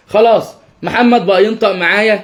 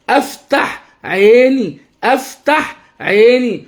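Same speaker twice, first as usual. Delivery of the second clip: أفتح عيني word by word, vowels stretched; drawn-out, sing-song أفتح عيني for a child to copy; slow modelling for repetition; 4 semitones higher, much slower than usual. Shown as a demonstration. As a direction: teach أفتح عيني slowly with a lilt. Models phrase for child to repeat.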